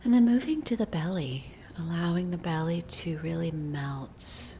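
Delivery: slow, soothing meditation voice with long pauses; unhurried guidance, letting each phrase settle; guided body scan meditation. Real, not acted. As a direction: lead them gently into relaxing, drawing out the phrases.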